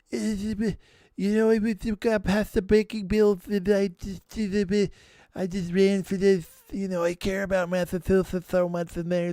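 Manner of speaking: garbled, slurred caricature of a congressman's speech; Barney Frank. Acted. Host mocking how a congressman talks, garbled impression. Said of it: "have you guys ever heard Barney Frank talk".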